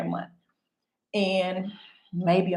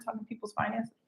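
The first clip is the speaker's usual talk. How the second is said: normal talking voice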